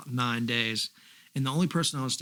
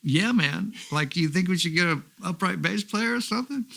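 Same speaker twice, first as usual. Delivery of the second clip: higher, louder keen suggestion; eager musician offering an idea to Billy; 6 semitones higher, 4 dB louder than usual. Retold or imitated. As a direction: pitch an idea brightly to the star. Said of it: "I said".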